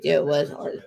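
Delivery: ordinary talk, nothing marked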